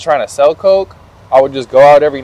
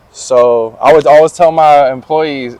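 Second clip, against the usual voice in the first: ordinary delivery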